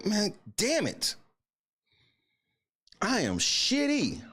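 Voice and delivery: high, slow, pained complaint; exasperated groan. Real, not acted. Fan moaning as the rival wins, high and slow. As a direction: moan it out in frustration. Fan upset as Nuggets win.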